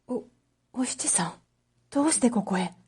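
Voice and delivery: put-on stammered, hushed whisper of a surprised young man; startled whisper. Kichisaburo to Oshichi sneaking in at night: startled hushed whisper. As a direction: woken in the dark, he breathes her name in disbelief.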